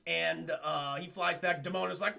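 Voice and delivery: normal talking voice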